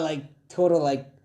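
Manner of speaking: ordinary delivery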